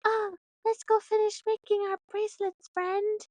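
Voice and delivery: put-on high sweet little girl voice; lightly voiced cheerful kid; child voice. Daughter Ava asking to finish bracelets, light high child voice. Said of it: "And Ava's like".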